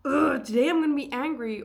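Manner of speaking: a loud, grumpy groaning line; hammed-up grumbling anger; mock angry. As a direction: act out being angry for the day. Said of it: "But it's lacking that".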